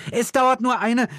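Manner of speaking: put-on high, loud girl's objection, cut off; urgent high-pitched protest; shouted protest. A narrator's reading. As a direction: yell back at your mother mid-dash. Girl protests to mother in panic.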